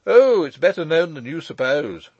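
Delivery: mild mocking banter; teasing retort. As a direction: needle the old man with a grin. Michael teasing uncle.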